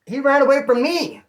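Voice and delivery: loud, high disbelieving exclamation; amazed. Real, not acted. Telling friends the cop fled, loud amazed. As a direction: marvel that the cop ran.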